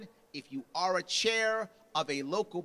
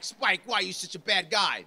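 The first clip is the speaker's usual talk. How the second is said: louder, scolding Spike; mock yell; 7 dB louder than usual. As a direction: yell it at him. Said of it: "I'm gonna yell at Spike".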